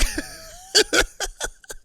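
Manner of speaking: high-pitched burst of laughter. Real, not acted. Storyteller cracks up recalling the beating, high laugh. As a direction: break into a squealing laugh.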